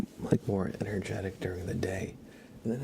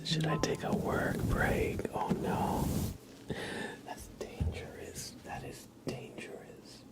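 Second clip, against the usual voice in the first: ASMR whisper; 7 semitones higher than usual. Acted. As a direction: whisper intimately while brushing. ASMR brushing and chat.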